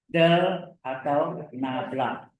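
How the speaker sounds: spaced out at half speed; slow repeat. Shown as a demonstration. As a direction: say each word slowly for the class. Guru mengulang istilah baru pelan-pelan. (diulang pelan, kata demi kata)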